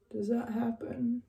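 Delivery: hushed wondering whisper; whispered to herself; whispered question. Real, not acted. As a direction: ask it under your breath.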